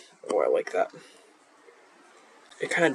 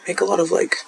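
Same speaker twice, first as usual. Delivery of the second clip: ordinary talk, nothing marked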